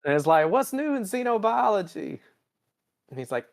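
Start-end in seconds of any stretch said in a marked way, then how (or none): 0.5-2.2 mock Kirk. Kirk voiced in recap.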